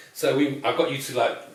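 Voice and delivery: usual speaking voice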